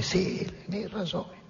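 high, very soft confirmation; acted hushed answer. Ahab answers the startled blacksmith, hushed and insistent. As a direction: confirm it in a small, high hush. Ahab's answer in retold exchange.

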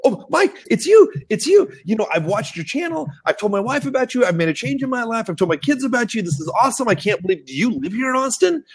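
high, gushing fan squeal; excited security guard meeting a famous YouTuber; starstruck fan. Retold or imitated. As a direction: gush like a starstruck fan meeting his idol. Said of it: "security guard looks at this guy and goes".

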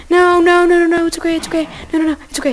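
high, loud frantic no's; panicked shouting. Real, not acted. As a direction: shout in panic, then try to calm. Panic then reassuring cow.

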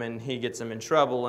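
normal talking voice

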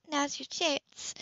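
sly, slow come-on; wicked invitation. Acted. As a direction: purr it slowly as a dare. Heroine invites, smiling wickedly.

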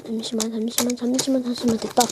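soft, low repeated muttering; hushed muttering. Real, not acted. Reviewer fiddling with the toy, muttering softly. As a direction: mumble to yourself while concentrating.

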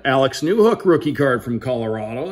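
ordinary talk

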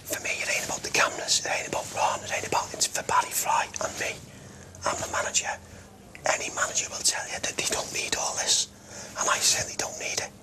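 soft hoarse whisper, no voice left; voiceless and worn out; knackered voice. Real, not acted. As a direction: confide through a blown-out voice. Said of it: "his voice".

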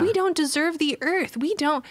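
put-on high, despairing child's lament; imitated heartbroken child. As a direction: mimic a broken-hearted kid insisting. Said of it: "Kirby is just broken".

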